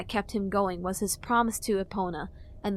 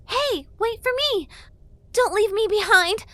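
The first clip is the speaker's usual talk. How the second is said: high, loud pushy fairy; squeaky, eager little fairy; fairy girl voice; 13 semitones higher, 7 dB louder than usual. A narrator's reading. Fairy Tattle voice.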